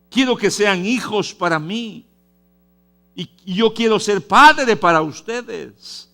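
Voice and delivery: high, loud pulpit voice; preaching at full force; fervent preaching. Acted. Preacher quoting God, fervent.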